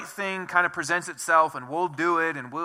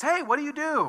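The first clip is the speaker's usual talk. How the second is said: chatty stranger voice; 10 semitones higher than usual. Retold or imitated. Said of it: "and says".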